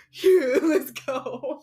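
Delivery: loud, slow, hyped cheer; pumped kid cheer. Retold or imitated. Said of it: "He was like".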